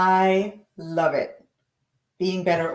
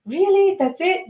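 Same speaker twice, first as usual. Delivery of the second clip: much higher, squeaky incredulous questions; surprised disbelief; 11 semitones higher than usual. Retold or imitated. Retelling her reply to God's advice, high disbelief. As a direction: react like you can't believe it. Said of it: "I was like".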